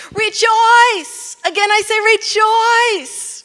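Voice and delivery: high, loud, drawn-out cry of joy; exultant shout. Acted. Preacher acting Paul rejoicing from prison, exultant shout. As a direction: shout it out, overjoyed, stretching the last word. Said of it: "think about somebody saying this".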